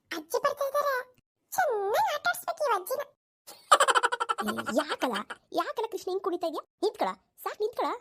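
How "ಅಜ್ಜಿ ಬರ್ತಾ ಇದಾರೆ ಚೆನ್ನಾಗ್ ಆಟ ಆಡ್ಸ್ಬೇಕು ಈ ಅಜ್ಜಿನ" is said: put-on high, slow childish sing-song; a sly kid plotting to tease granny; playful child voice. Grandson plotting to tease granny, high sing-song child voice.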